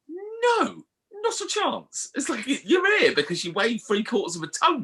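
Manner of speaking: very high, indignant mock scolding; hammed-up high-pitched telling-off; exasperated doctor. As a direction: refuse as an appalled doctor. Voicing exasperated doctor refusing.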